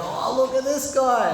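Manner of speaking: high, loud mock-astonished call; excited onlooker. Pub drinkers spotting the drummer, excited imitated shout. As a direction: imitate a rowdy drinker hailing a newcomer.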